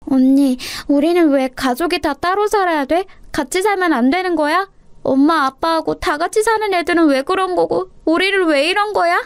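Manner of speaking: put-on high small-girl voice, pleading; plaintive little sister. Little sister asks why the family must live apart, plaintive. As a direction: ask like a hurt, bewildered child.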